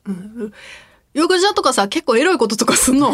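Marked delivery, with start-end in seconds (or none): 1.1-3.1 high, loud, carrying voice; way too loud. Retold or imitated. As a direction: blurt it so the whole room hears. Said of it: "声量間違ってて". (声量を間違えた大声)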